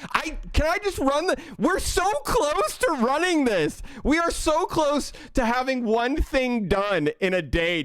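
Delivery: high, pleading disbelief; exasperated pleading. Real, not acted. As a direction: beg chat to let you finish. Pleading with chat.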